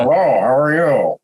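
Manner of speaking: odd alien singer voice; Sy Snootles impression. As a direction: voice a smoky alien diva. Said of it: "very odd Sy Snootles impressions".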